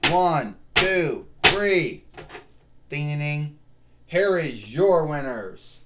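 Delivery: loud, excited play-by-play call; wrestling commentator. Pretend. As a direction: call the pin and winners like a ring announcer. Kid calls toy match finish.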